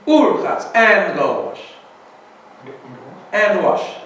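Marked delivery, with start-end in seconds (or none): 0.1-0.7 "Ur" called out high and loud; stress on Ur. Shown as a demonstration. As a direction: punch "Ur" in "Ur Chatz" as the key point. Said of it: "The first washing is Kadesh".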